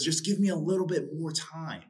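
high begging plea, retold; pleading servant. Servant pleading with the king for time, high begging. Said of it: "he pleads with him".

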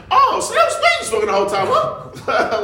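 high, amused mock astonishment; retold realization. Nephew catching on to the weed, high comic surprise. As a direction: exclaim it as if it just clicked.

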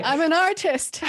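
high, loud mock artist; a hammed-up snooty artist. Guest mocking the creative type, high and loud.

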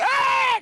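very high mock scream; mother startled by hiding child, screaming; retold mom scream. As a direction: shriek back like a startled mom. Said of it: "And she screams".

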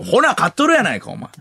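high-pitched comic retort; 内海 impression. Retelling a colleague doubting the gambler, retort impression. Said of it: "内海さんは言うてたよ".

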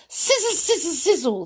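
high, voiced hiss of flames; sizzling flames. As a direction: say it as the fire dying. Flames hissing out.

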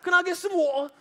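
high, loud mock-British voice; British accent. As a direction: ham up a cheery British accent. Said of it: "'cause he's British".

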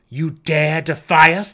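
loud, slow, outraged challenge; angry cry. A narrator's reading. Drow facing the defiant goblin chief, angry loud cry. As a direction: rage at their defiance. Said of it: "the drow cried".

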